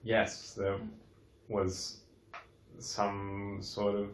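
soft, slow hushed voice. Acted. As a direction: hush it so nothing hears. Hiding, speaking low.

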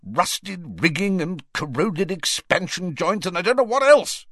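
exasperated fury; furious. A narrator's reading. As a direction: fling the blame back furiously. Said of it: "said the Prime Minister furiously".

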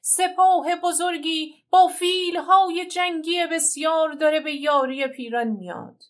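high, loud warning cry; lookout's alarmed shout. Acted. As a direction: shout the bad news down from the mountain. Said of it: "دیدبان فریاد زد".

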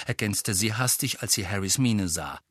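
ordinary delivery

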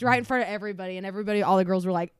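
normal talking voice

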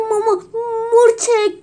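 high, loud, sobbing wail, stuttering; crying out. Acted. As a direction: wail for mama in panic. Bitten by ants, crying out.

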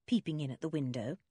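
ordinary delivery, nothing marked

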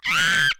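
loud, very high-pitched short scream; fan scream. Retold or imitated. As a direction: shriek like an overwhelmed fan. Retold scream at idol's compliment.

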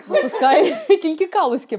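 loud laughing through words. Real, not acted. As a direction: laugh while answering. Laughs about hometown beer brand.

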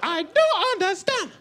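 high, slow, outraged African auntie; aunt's African accent. Retold or imitated. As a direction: squeal then drag out the disbelief. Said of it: "She was like".

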